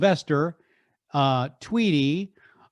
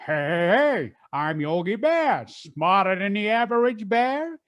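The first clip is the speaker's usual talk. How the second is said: hearty, bigger-than-life cartoon bear voice; lightly higher, jolly cartoon bear; Yogi Bear impression; 5 semitones higher than usual. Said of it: "Yogi always had that bigger than life persona".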